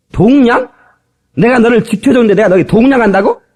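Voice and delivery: high, loud indignant retort; indignant mock outrage. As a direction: bristle at the insult, pitch up. 다윗이 화내는 장면. (높고 크게 억울해하며 따지는 말투)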